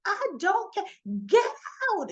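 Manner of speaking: high, loud yell of get out. Retold or imitated. Trina ordering Cyrus out, a loud high yell. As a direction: shout him out of the room. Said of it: "she's yelling and pointing".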